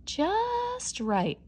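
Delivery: contented murmur. Acted. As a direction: sink happily into the pillow. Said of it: "Aloki murmured".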